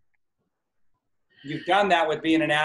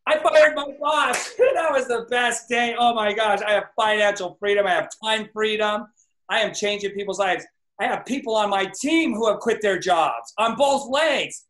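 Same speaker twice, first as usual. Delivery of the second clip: higher, jubilant declaration; excited affirmation; 4 semitones higher than usual. Shown as a demonstration. Coach demos a joyful affirmation for the team. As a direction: celebrate each line as true now. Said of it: "So now it's".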